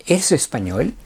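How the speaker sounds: ¿eres español? with a rising question tune; Spanish question intonation. Shown as a demonstration. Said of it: "el acento y la entonación cambia".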